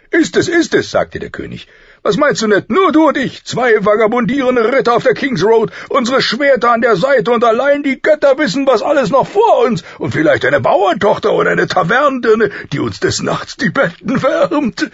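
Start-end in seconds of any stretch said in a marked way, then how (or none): 0.1-0.9 high, loud, eager affirmation; emphatic insistence. A narrator's reading. King assuring his friend he means it, emphatic. 2.0-14.9 high, booming, rollicking voice; exuberant dreaming king. A narrator's reading. King tempting his friend to run off, exuberant.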